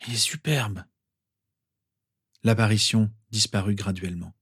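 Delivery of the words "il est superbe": soft, hoarse, moved murmur. A narrator's reading. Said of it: "murmura d'une voix enrouée".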